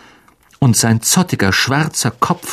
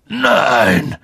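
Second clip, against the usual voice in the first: rough growl of refusal; gruff, throaty objection; 3 semitones higher than usual. A narrator's reading. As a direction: growl it out in protest. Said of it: "knurrte Hagrid".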